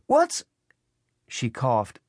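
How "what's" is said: very high, broken-off question; high-pitched woman's call, cut short; Eddie calling. A narrator's reading. Elderly woman interrupted by smoke, calling out. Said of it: "she called".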